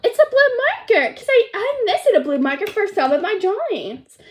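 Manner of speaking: high, loud pretend delight; excited pup. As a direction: gush over a present in play. Sky discovers her gift.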